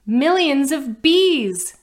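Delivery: high, loud and slow alarmed cry; child crying out. Acted. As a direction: stretch it out loud in panic. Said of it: "Cindy cried".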